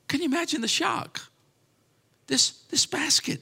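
very high, softened amazement; hushed astonishment. Acted. Preacher imagines the shock of the basket's contents, hushed astonishment.

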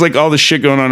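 ordinary delivery

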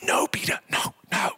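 hushed whisper. Retold or imitated. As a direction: whisper it like a nervous friend. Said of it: "just going".

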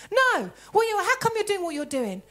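mild imitation of a resentful questioner; mock indignant. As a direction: press the question with attitude. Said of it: "in attitude".

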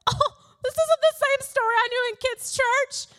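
wide-eyed, hammed-up surprise; mock shock. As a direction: play her past self caught off guard. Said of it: "I was like".

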